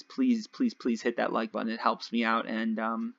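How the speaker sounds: normal talking voice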